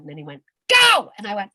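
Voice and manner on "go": loud high barked order; shouted command. Retold or imitated. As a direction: yell go at the jumper. Jump instructor's command.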